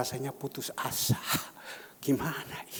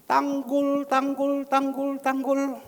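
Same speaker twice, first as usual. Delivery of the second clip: calling out; 9 semitones higher, 6 dB louder, slower than usual. Retold or imitated. Calling out the Tanggul man.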